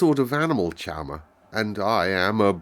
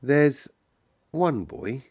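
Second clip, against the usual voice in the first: halting, pitched up, pausing between words; slow, thinking aloud; 6 semitones higher, much slower than usual. A narrator's reading. Sick boy considering an exception, slowly and thoughtfully. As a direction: think out each word. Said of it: "he said quite slowly".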